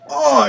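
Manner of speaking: high-pitched "oh" of recoil; re-enacted shocked reaction. Player recalling a gory kill, high reacting cry. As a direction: cry out as if watching a leg blown off. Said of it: "I literally went".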